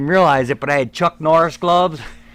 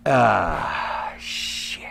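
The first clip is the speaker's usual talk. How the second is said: low, quiet deflated groan of dread; mock-crushed, almost voiceless; deflated sigh; 9 semitones lower, 7 dB quieter than usual. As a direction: let it sink out under the breath. Retelling his dejected reaction.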